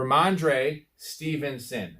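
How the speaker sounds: Rhamondre Stevenson spaced out syllable by syllable, slow; slow careful enunciation. Shown as a demonstration. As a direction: say Rhamondre Stevenson slowly, each syllable bounced. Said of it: "Is that better?".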